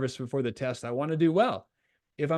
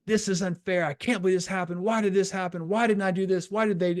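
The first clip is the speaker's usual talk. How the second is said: higher-pitched mock complaint, running on; venting; 4 semitones higher than usual. Coach showing a five-minute vent after mistakes, venting. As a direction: rattle off grievances in a pretend vent. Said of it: "let yourself just vent".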